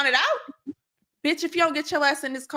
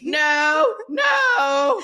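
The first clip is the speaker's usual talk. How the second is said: much higher and louder, yelling no; loud protest; 6 semitones higher, 6 dB louder than usual. Real, not acted. Friend shouts down her self-put-down, loud protest. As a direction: shout her down, half laughing.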